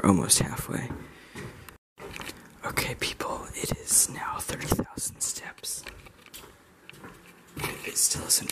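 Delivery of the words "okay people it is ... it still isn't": hushed whisper into the mic. Real, not acted. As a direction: whisper so nobody wakes. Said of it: "I have to whisper into the microphone".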